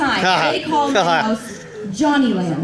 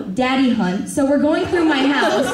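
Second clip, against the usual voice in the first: ordinary talk, nothing marked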